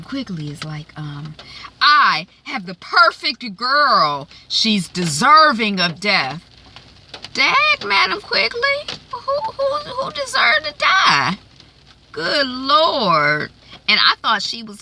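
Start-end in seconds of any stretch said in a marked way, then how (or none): 1.8-6.4 slow, hammed-up villainess declaration; scheming madam. Voicing villainous Madam Quigley. 7.3-11.4 very high, squealing mock outrage; shocked disbelief. Host's own shocked reaction. 12.1-13.5 high, drawn-out dismay; aghast exclamation. Pretend. Host's own shocked reaction.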